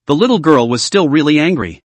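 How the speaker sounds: ordinary delivery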